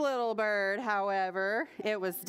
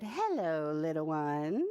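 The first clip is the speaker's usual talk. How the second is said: low, smooth purr of a greeting; purring cat; 7 semitones lower than usual. A narrator's reading. Cat greeting the little bird, low purr. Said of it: "The cat purred softly and said".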